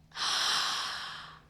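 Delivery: long, loud intake of breath; amazed gasp. Retold or imitated. Jacket wearer hearing unexpected praise, amazed gasp. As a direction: gasp in delighted surprise. Acts out own surprised reaction.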